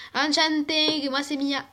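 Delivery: put-on Marseille accent, "enchantée" ending in a hard "-ing"; Marseillais accent. Mia greets the newcomer, thick Marseille accent. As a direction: introduce yourself in broad Marseillais. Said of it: "c'est mon accent".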